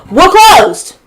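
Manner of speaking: sharp high shout across the room; loud shout of closed from across the restaurant; retold call-out. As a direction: yell it out like a worker calling to the door. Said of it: "someone calls out".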